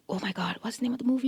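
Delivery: quick half-whispered self-prompt; hushed aside. Real, not acted. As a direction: whisper it fast, searching your memory. Forgets film title mid-sentence.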